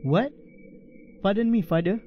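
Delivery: usual speaking voice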